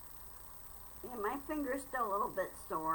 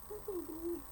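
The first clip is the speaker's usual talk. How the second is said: cooing to a pet; 10 semitones higher, 5 dB quieter than usual. Real, not acted. Cooing to the cat.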